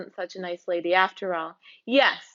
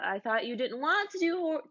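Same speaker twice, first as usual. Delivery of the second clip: normal talking voice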